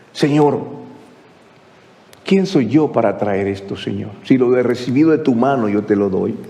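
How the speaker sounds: low, reverent address to God; acted low-pitched prayer of gratitude; humble prayer.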